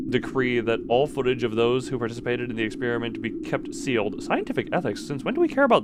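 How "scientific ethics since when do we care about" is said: high, mocking disbelief; sarcastic incredulous question; incredulous scoff. Real, not acted. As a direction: repeat it like you can't believe it. Scoffs at log's claim.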